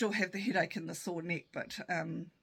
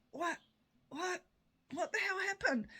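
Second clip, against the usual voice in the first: high, stunned little questions; mock bewilderment; 12 semitones higher than usual. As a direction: act out coming to, baffled, in a high voice. Acts out dazed self after falling.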